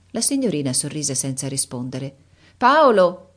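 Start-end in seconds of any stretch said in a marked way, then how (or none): 2.6-3.3 high, loud call from behind a screen; countess shouting. Acted. Countess calling a servant from behind the screen, loud high shout. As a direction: yell the name across the room. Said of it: "gridò la contessa".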